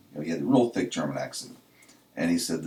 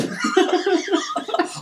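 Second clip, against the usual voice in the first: breathless, high-pitched laughing fit; cracking up at the punchline; helpless high giggle; high wheezing laugh; 5 dB louder than usual. Real, not acted. Laughs at own story.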